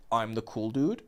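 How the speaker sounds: imitating low, soft nonchalance; cool dude. Coach mimicking guys who hide their emotions, aloof. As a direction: play it too cool to care. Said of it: "they always try to play this".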